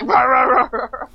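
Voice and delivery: high "rah rah" yapping, trailing away; barking neighbor dog. Retold or imitated. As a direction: imitate a dog barking behind a fence. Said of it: "the dog of the next door neighbor".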